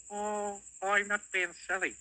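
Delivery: high playful goblin. Acted. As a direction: protest lightly as a goblin. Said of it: "said the goblin".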